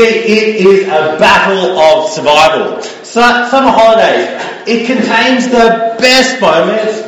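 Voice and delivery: usual speaking voice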